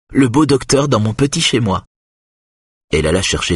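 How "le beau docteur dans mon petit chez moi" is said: high-pitched, lightly excited teasing; giggly exclamation. A narrator's reading. Woman admiring the doctor in her home, giggly exclamation. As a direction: lift the voice high, playfully delighted. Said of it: "s'écria-t-elle en pouffant".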